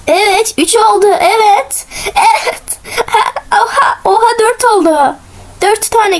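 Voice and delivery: high, giddy amazement; excited cheering. Real, not acted. Player amazed at clearing a fourth one, excited and high. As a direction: celebrate in disbelief, voice jumping up. Celebrating passing another obstacle.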